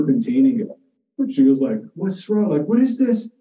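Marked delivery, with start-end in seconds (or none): 2.0-3.3 higher, rising alarm, teacher scolding; mock freaked-out teacher. Teacher scolding a seventh grader, higher alarmed mock outrage. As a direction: play the teacher losing it. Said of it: "she freaked the hell out".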